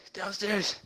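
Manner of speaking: weak dying voice. Acted. Near death, gasping instructions.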